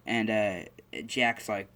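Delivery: ordinary delivery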